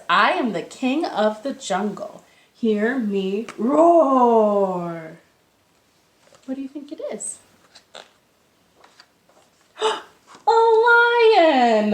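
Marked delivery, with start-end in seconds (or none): 0.1-5.2 proud jungle king, drawn out roar on the end; lion voice. Acted. Storyteller voicing the lion clue for young listeners. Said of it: "And it says". 9.8-11.9 gasping, high and loud discovery; excited gasp. Acted. Teacher pretending to discover the answer for kids, thrilled.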